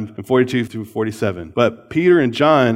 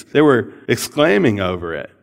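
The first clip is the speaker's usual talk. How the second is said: ordinary delivery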